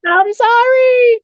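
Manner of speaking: high, drawn-out mock wail; acted-out frightened pleading, stretched long; panicked apology. Retold fleeing from the spirit.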